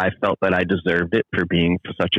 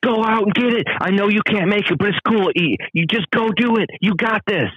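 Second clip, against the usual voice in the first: much higher, encouraging voice; supportive cheer; 13 semitones higher than usual. Retold or imitated. True friends encouraging him, bright and supportive. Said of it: "They would say".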